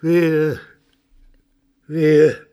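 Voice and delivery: high, loud grieving reproach; anguished accusation. Acted. The old father to Franz, accusing him in anguished cries. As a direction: hurl the blame at him.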